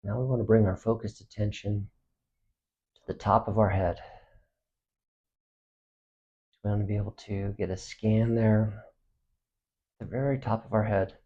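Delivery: soft, unhurried meditation voice with long pauses; hushed, spacious relaxation guidance; guided body scan meditation. Acted. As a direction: guide them gently through the body, quietly.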